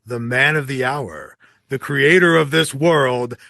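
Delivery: usual speaking voice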